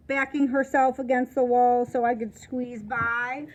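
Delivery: ordinary delivery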